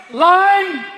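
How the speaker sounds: loud call for his line. Retold or imitated. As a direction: shout for the prompter. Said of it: "on a porn movie set going".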